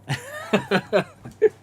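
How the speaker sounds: high rising laugh; high cackle. Real, not acted. Laughing after mocking the fan, high cackle. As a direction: laugh up high at your own rant.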